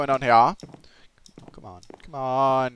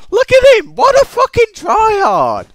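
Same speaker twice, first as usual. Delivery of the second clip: very high, loud angry screech; pissed-off shriek; 10 dB louder than usual. Real, not acted. Gamer beaten by a tryhard, pissed-off screaming. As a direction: scream in exasperation at the winner. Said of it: "I'm actually pissed off".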